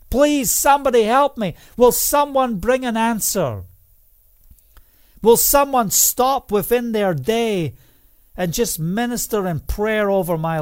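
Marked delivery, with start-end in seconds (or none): 0.1-1.5 higher, louder, desperate cry for help; preacher crying out for help; loud plea. Retold or imitated. 1.8-7.7 higher and louder, rising passion; impassioned sermon climax; fiery preaching. Acted. Preaching the vision at full force.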